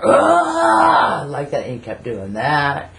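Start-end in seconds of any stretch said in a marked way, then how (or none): 0.0-1.2 high, loud mock cry; imitating his wild yell; imitated drunk yell. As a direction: belt out a raw drunken howl. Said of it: "he does, like, a, a growl".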